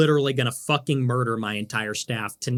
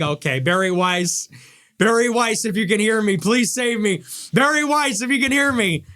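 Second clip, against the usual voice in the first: mock desperate plea; 7 semitones higher than usual. Mocking a parent, calling out for Barry Weiss in desperate pleading. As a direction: beg Barry Weiss to save you. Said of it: "and you're like".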